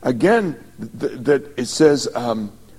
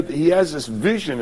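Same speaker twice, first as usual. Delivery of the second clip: usual speaking voice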